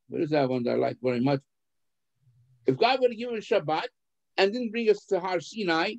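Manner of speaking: ordinary talk, nothing marked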